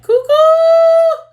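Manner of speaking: very high, loud, drawn-out holler; imitated calling out. Grandma calling the girl home, imitated high holler. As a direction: holler for a child far away, imitated.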